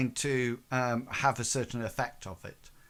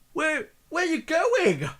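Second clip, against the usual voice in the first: high, startled gang member calling after his mate; nervous thug; 5 dB louder than usual. Acted. Gang member calling after his vanishing mate, high and startled. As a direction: squeak it out as he backs off. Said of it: "and he says".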